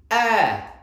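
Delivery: high, loud call; loud teacher's demonstration; big loud voice. Shown as a demonstration. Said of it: "in a big loud voice".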